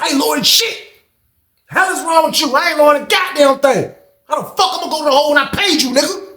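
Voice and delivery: high, fast, outraged defiance; yelling back at the guard who told him to hush; heated refusal. Retold or imitated. Refusing to lower voice.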